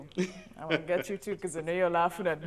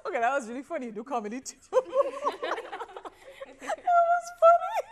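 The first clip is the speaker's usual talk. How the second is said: laughing hard; 12 dB louder, faster than usual. Real, not acted. Host cracking up after the contestant's song, laughing. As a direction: talk through real helpless laughter, pitch up. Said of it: "I don't know why I'm laughing so much".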